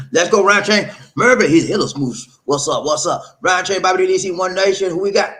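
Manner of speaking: loud, high-pitched greeting shout; hype call-out. Real, not acted. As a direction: holler the roll call to the crowd. Host hypes the next commenter.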